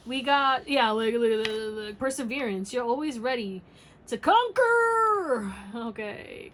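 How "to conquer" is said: drawn out, higher and louder; dramatic flourish. Acted. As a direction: stretch it like a battle cry. Dramatic tarot reading climax.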